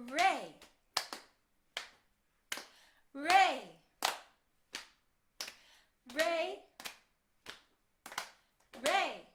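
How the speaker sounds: "Re" barked short and loud; loud clapped beat. Shown as a demonstration. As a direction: punch out each "Re" hard. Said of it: "it has lots of rests".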